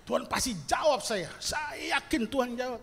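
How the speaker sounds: high, forceful claiming; insistent prayer. Acted. Preacher mimics his younger self demanding God answer, high and insistent.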